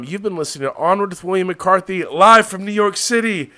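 loud, high announcer sign-off; host signing off to listeners, loud and high; show sign-off. Acted. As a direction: close the show like a host shouting goodbye. Podcast sign-off.

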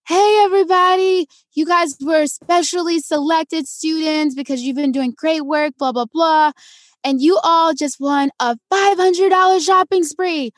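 excited announcer. Retold or imitated. Volunteers telling kids they won a shopping spree, excited announcement. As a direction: imitate a host revealing a big prize.

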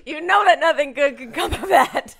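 loud, high, laughing outrage; laughing protest. Real, not acted. Guest scolding an awkward listener question, laughing outrage. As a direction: protest loudly through helpless laughter.

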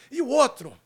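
hyped announcer. Acted. Host hyping the guest.

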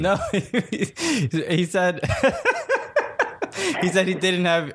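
breaking into laughter through the words; laughing. Real, not acted. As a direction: laugh while you get it out, barely able to speak. Laughing while retelling the gaffe.

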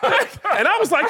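high-pitched laughter breaking through; real laughter, voice jumping high; laughing. Real, not acted. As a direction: crack up while telling it. Laughing at coach falling.

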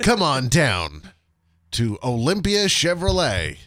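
slow nasal car dealer pitch; hammy used-car ad read; car commercial voice. Pretend. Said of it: "Now that I have the nasally voice".

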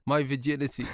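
normal talking voice

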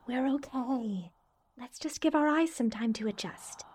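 calm soothing. Acted. Calming friend in dark.

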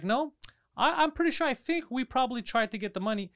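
hammed-up clerk excuse; mimicking the clerk. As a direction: parody the clueless clerk. Mimicking clerk in retold argument.

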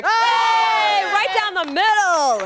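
loud slow cheer; excited shout. Real, not acted. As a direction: shout it out, drawn out. Cheering a sword slice.

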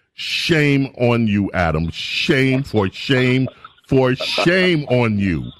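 drawn out, each shame stretched slow; slow scolding. Real, not acted. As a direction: scold him slowly, like a disappointed preacher. Host scolding caller over Israel.

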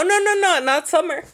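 lightly voiced stubborn dad; imitating dad. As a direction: protest like an obstinate dad. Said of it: "this man is like".

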